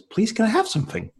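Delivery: put-on high, sweet little-boy voice asking nicely; a small son politely begging for a treat. Dad voicing his polite son asking for something, high and sweet.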